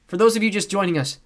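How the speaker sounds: put-on high, loud girl reporter; amateur TV reporter. A narrator's reading. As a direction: open a broadcast brightly, pitched high. Girl playing TV reporter on air.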